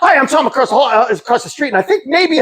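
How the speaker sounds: high-pitched nervous introduction; nervous fast talk. Retold or imitated. Neighbor at a drug dealer's door, nervous high babble. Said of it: "I better talk fast".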